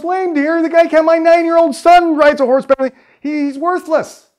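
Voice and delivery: high, loud disbelieving outburst; incredulous scoff. Acted. Fleet Arrow to the chief, high scornful disbelief. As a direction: scoff at the idea, voice shooting up.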